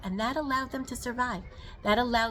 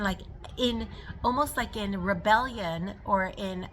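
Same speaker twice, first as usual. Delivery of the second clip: normal talking voice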